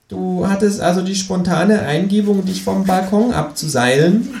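high, slow, needling kangaroo. Acted. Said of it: "fragt das Känguru". (hohe, langsame, stichelnde Känguru-Stimme)

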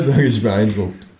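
usual speaking voice

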